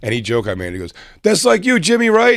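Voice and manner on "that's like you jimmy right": high, loud heckler voice calling out from the crowd; loud mock heckle. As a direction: shout it up high like a rowdy audience guy. Crowd member heckling on stage.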